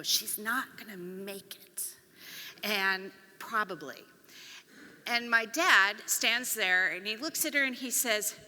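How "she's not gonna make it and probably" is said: slow unvoiced whisper of the principal. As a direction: stretch it out, breathy and hushed. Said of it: "we're very slow and long".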